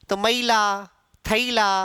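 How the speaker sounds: "मैला, थैला" with ऐ split into a-ee; old-style glide to ee in मैला and थैला; old pronunciation. Shown as a demonstration. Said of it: "पहले आई बोलते थे ना".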